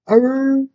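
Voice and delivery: high, held imitation of a howling dog. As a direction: howl like a dog in the night. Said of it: "These fools were howling".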